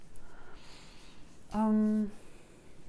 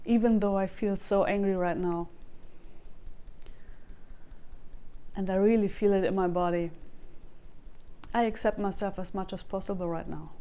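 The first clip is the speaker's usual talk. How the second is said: tapping script. Acted. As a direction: say each line for viewers to echo back. Leads a tapping sequence.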